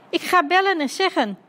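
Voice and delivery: high, eager exclamation; excited announcement. Acted. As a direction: blurt it out high and keen. Dummy eagerly grabs the phone. (opgewonden uitroep)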